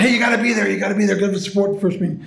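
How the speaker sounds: imitated pleading, high and hurried; insistent urging. Founder begging friends to support the first meeting, insistent urging.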